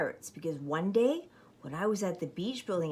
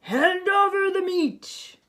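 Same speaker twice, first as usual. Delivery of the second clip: higher, louder, slow pirate demand; pirate captain demanding; 11 semitones higher, 7 dB louder, slower than usual. Acted. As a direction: bark it like Braidbeard. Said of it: "gulped his food and said".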